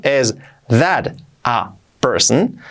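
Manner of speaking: is that a person stretched very slowly. Shown as a demonstration. Teacher repeats slowly for beginners. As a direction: drag each word out slowly. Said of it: "Dicho despacio".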